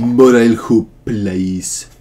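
loud, drawn-out Spanish-style name; Spanish pronunciation. Shown as a demonstration. Player guessing how a friend's name is said, exaggerated Spanish. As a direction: overdo a Spanish accent, loud and slow. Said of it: "Is it Spanish?".

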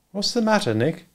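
a lightly voiced young woman, a little concerned; Marjorie's voice. A narrator's reading. Marjorie asking Nick what's wrong, lightly concerned.

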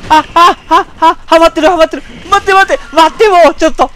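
screaming in real panic as health drops; real frantic screaming. Real, not acted. Player about to die again, frantic screaming. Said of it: "先ほどは取り乱しました。".

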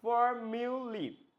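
slow, syllable-by-syllable ফর্মুলি with lee at the end; careful for-miu-lee for ফর্মুলি; lee, not lai. Shown as a demonstration. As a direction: draw out ফর্মুলি, final long ee. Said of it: "উচ্চারণ লাই না কিন্তু".

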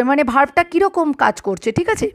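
ordinary talk

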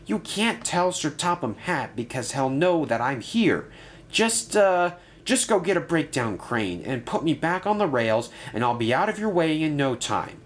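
lightly voiced diesel begging Ryan; Sidney the diesel. A narrator's reading. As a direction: beg him not to cross. Sidney pleads.

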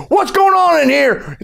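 high-pitched caricature cop; alarmed policeman. Acted. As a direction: burst in as an overblown cop demanding answers. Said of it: "the police kicked down the door".

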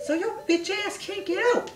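very high, taunting cartoon creature voice; mocking fish voice. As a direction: tease the trapped hero in a squeaky voice.

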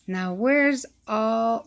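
ordinary delivery

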